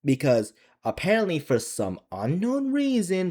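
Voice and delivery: usual speaking voice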